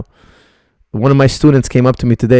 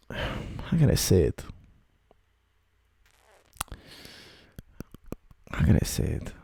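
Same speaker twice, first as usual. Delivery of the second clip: muttering to himself; 15 dB quieter than usual. Real, not acted. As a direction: mutter it under your breath while thinking. Searching for words, to himself.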